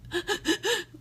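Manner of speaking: hammed-up sobbing gasps; quick, high mock sobs; mock bawling. Shown as a demonstration. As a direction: imitate someone gasping through heavy crying. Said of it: "When I say crying, I mean".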